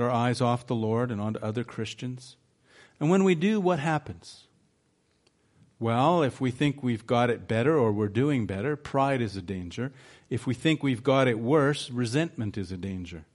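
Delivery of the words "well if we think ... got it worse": usual speaking voice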